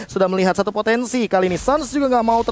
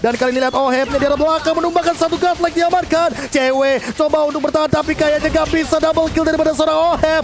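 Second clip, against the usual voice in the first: fighting game announcer; 7 semitones higher than usual. Real, not acted. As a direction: build the hype to the kill. Caster climax to first point.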